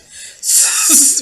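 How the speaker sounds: sharp breathy burst of nervous laughter. Real, not acted. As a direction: laugh it off out of fear. Nervous laugh after scary question.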